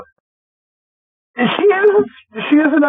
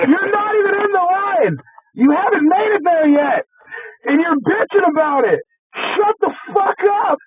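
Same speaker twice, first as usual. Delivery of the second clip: higher and higher, ending in a shout; imagined angry rant; 11 semitones higher than usual. Retold or imitated. Manager voices his unsaid rant at the rude lady, rising to a shout. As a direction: build the scolding up to a yell. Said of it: "you're just like".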